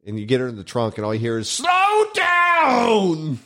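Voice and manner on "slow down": put-on old lady yelling.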